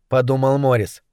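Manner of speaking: plain reading voice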